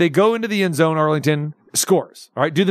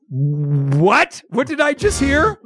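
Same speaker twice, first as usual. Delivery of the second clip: mock disbelief, leaping up high at the end; incredulous; 7 semitones higher, slower than usual. Viewer reacting to the announcers' remark, retold incredulity. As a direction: stretch the first word, then a high baffled question.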